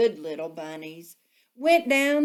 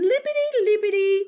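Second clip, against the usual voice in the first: playful; 12 semitones higher, 5 dB louder than usual. A narrator's reading. Playful sound of hopping.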